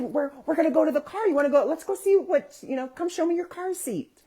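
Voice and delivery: very high, excited coaxing; talking to small child. Retold or imitated. Adult coaxes child to the car, high excited child-directed voice.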